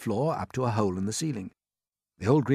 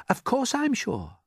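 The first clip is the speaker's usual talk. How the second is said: higher, sure and steady; confident James; slower than usual. A narrator's reading. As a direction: reassure them firmly. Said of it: "answered James".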